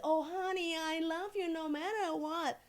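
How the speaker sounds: high, drawn-out mock-loving parent; gushing accepting parent. Imagined parent instantly accepting a child coming out, gushing and high. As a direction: coo it like an idealised doting parent. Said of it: "they don't stand up and say".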